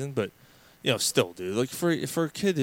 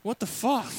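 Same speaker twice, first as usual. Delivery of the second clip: baffled batter; 10 semitones higher than usual. Retold or imitated. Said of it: "the batter's standing like".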